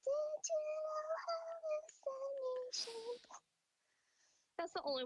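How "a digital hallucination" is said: Fluttershy voice. Acted. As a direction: sing it timidly like Fluttershy. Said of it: "sing it in a Fluttershy voice".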